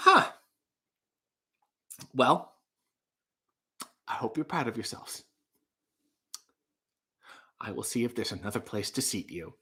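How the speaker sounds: soft, deflated, disapproving waiter. Acted. Waiter after the table is smashed, quiet stiff disapproval. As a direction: quietly scold the guests with wounded dignity. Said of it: "That was my impression of the waiter".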